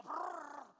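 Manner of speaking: rolled rumble of thunder; imitated thunder. Preacher painting the storm at sea, imitated thunder. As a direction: trill out a burst of thunder. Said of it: "y los truenos".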